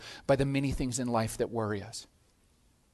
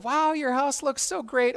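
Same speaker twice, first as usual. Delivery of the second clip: gushing guest; 9 semitones higher than usual. Retold or imitated. Guest praising the tidied house.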